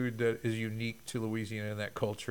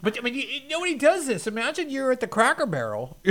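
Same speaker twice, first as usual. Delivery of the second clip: usual speaking voice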